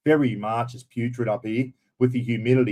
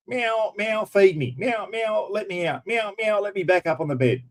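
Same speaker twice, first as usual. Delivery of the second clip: whiny cat voice; 8 semitones higher than usual. Retold or imitated. Owner voicing his pestering cat at morning, high whiny meows. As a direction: whine it out like a needy cat. Voicing the annoying cat's demands.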